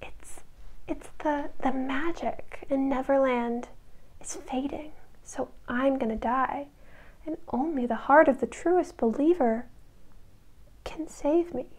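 very soft, faltering invalid voice; hammed-up feeble sickbed murmur; pretending to be sick. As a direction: fake a weak, dying plea with halting pauses. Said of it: "she's pretending to be sick".

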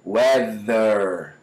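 "Weather" with a held buzz inside; vibrating TH. Shown as a demonstration. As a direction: buzz the tongue tip in "Weather". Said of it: "Olha como é que vibra".

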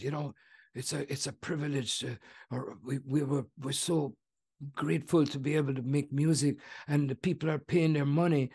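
soft, quiet, gentle crooner's speaking voice. Retold or imitated. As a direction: murmur it softly like a careful old singer saving his voice. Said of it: "Good impression".

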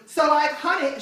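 exaggerated girlfriend impression; annoying girlfriend. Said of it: "And then she goes".

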